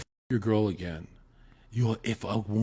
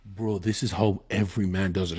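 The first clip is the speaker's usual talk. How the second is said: ordinary talk, nothing marked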